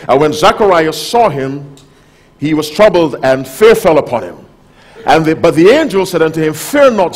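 loud, ringing Bible reading; preacher reading Luke aloud, full voice; scripture reading. Acted. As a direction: proclaim the verses to the congregation. Reads scripture from the pulpit.

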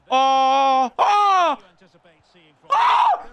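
real building excitement; rising excitement. Real, not acted. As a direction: build to a yell as the goal goes in. Goal building up.

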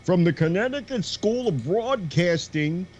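hammed-up high commercial voice; radio commercial pitchman. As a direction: imitate a local radio host selling in a commercial. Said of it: "does a commercial for".

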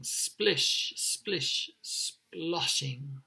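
"Splish, splish, sploshing" spaced out with long sh; drawn sh sounds. Shown as a demonstration. Teacher shows how rain words sound, "Splish, splish, sploshing" drawn out. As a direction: hold the hushing sh in "Splish, splish, sploshing". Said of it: "That sh sound in there is really effective".

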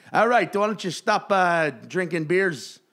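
loud, high Chicago cop bark. Retold or imitated. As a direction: yell like a South Side Chicago cop. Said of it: "John Mulaney doing J J Bittenbinder's voice".

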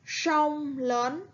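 sông lớn read along slowly; sông lớn with a clear ông rhyme, lớn rising. Shown as a demonstration. Teacher leads pupils reading back, clearly. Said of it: "Các bạn hãy đọc lại cùng cô".

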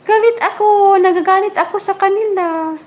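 high mock-grumpy puppy voice; voicing the dog. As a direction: pout it out as the little dog. Speaking as the angry puppy.

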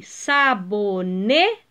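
"sabonete" slow, syllable by syllable, strong "ne"; penultimate stress. Shown as a demonstration. Teacher showing pupils the tonic syllable, stressing "ne" in slow "sabonete". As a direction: spell out "sabonete" by syllables, punching "ne". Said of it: "O ne é a sílaba tônica".

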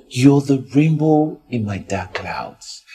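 ordinary delivery

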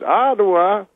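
imitating Cosby's puzzled repeat; Bill Cosby. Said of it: "He goes".

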